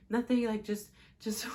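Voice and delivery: retold breathless, broken-off reply; through breaths. Panicking woman answering her worried boyfriend, through breaths. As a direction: struggle to get the words out between breaths. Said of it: "through my breaths, I'm like".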